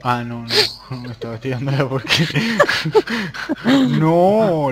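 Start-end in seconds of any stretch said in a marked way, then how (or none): 1.9-4.7 laughing. Real, not acted. Laughing at a joke.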